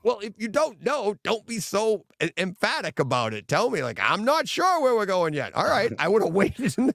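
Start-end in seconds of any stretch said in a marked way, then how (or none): 0.0-5.5 retold scolding. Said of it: "I'm like".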